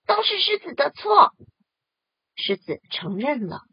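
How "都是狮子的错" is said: 高而响的群体怒喊; 一群动物一起冲着河马喊; 齐声吼叫. Acted. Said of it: "都生气地大声吼叫". (大声怒吼)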